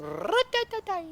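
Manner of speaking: playful R-rolling demo; rolled R's. Shown as a demonstration. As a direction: roll the R in Ra-ta-ta-tai hard. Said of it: "I can't roll my R's".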